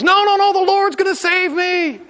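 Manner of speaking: stranded man refusing the log, self-assured; stubborn refusal. Acted. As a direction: refuse with smug faith.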